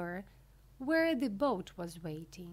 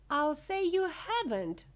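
high, loud indignant cry; raised storybook outcry; 7 semitones higher, 6 dB louder than usual. A narrator's reading. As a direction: cry it out as an upset mother. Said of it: "cried Missus Beauregard".

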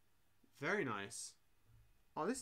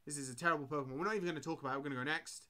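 normal talking voice

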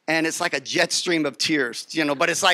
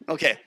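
mock gasp; 4 semitones lower than usual. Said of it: "and you're like".

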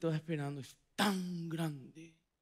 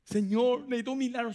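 higher, louder desperate plea; pleading cry; 7 semitones higher, 4 dB louder than usual. Acted. Mother begging Jesus for her daughter, a loud plea. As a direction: beg for the miracle out loud.

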